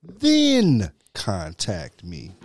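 very slow, each word spelled out; slow and deliberate. Shown as a demonstration. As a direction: lay out the order slowly. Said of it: "Listen to that very closely".

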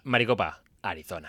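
low, hushed movie-title read; film title voice. Pretend. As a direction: drop low and soft like a film trailer title. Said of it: "si fuese una película sería".

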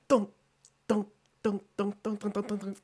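high sung dunk dunk drum imitation; imitated drum beats. Shown as a demonstration. Describing the dance music, she mimics the drums. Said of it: "like the".

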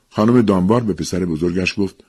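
usual speaking voice

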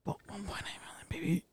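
unvoiced conspiratorial whisper, quick. Asked to repeat the goal, he whispers it conspiratorially. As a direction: whisper the number like a secret. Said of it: "say that again?".

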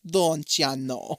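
put-on slow, drawn-out Minnesota accent; mock Minnesota accent. Friend teasing about Midwest talk, mock Minnesota accent. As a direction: stretch the vowels in a joke Minnesota accent.

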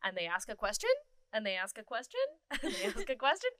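each line rising at the end like a question; question inflection. Shown as a demonstration. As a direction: let it lift up on question. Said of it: "your inflection going up at the end of a question".

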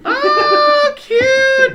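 very high, loud cooing; high-pitched gushing; delighted squeal. Real, not acted. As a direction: squeal at how cute it is. Cooing over declared love for someone.

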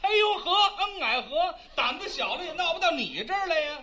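high, laughing bandit brag; a half-hearted tough-guy boast; mock swagger. Facing the bandit chief with a mock, laughing swagger. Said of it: "我们老爷学横的".

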